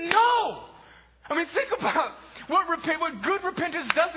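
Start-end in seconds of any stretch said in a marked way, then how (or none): 0.1-0.6 defiant no. Acted. Refusing to stay outside.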